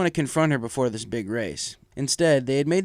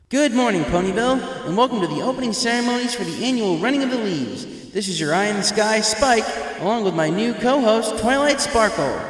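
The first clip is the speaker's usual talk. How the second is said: much higher, louder excited announcer; baby dragon announcer; 9 semitones higher, 5 dB louder than usual. Acted. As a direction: welcome the whole crowd like a young dragon. Spike announcing race over crowd.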